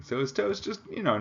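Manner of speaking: toe's swoops up very high in disbelief; incredulous rise. Shown as a demonstration. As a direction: ask "So his toe's just," in shocked disbelief. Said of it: "That is disgusting".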